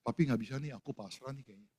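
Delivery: soft, defeated daughter's message; giving up, a touch of despair; resigned daughter. Retold or imitated. Daughter texts father she has given up, softly defeated. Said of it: "anak saya tuh WhatsApp saya".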